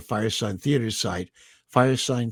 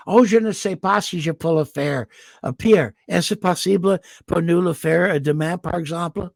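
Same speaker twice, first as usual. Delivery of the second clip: imitating an American girl's French, with no French accent at all; mock her flat American-accented French, a touch higher; bad American French; 7 semitones higher, 3 dB louder than usual. American girl asks Pierre something in French, heavy American accent. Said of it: "no French accent at all".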